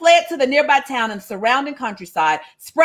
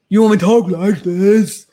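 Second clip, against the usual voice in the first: bass voice; 5 semitones lower, 5 dB louder than usual. Shown as a demonstration. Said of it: "put some bass in the voice".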